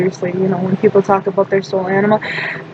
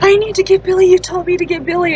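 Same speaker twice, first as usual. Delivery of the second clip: high, urgent arguing back; reenacted frantic insistence; insistent protest; 8 semitones higher, faster than usual. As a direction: argue back in a raised pitch. Acted quarrel with mom.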